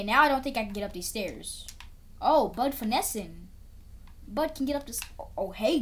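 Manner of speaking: usual speaking voice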